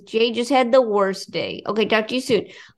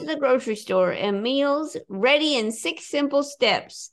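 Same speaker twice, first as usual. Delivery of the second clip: ad read; 3 semitones higher, 3 dB quieter than usual. Acted. Reads sponsor ad.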